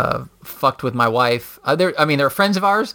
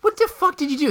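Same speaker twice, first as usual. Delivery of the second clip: very high, fast outraged question; incredulous outrage; 13 semitones higher, faster than usual. Retold or imitated. He confronts the pranking neighbor, high fast outrage. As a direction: demand an answer. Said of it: "I'm like".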